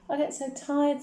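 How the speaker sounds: put-on high, weary little girl's voice; plaintive child. A narrator's reading. Daughter confiding about school, plaintive.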